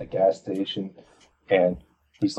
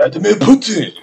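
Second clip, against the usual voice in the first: mock Russian accent; 12 semitones higher, 9 dB louder than usual. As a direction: bark the name in a broad fake accent.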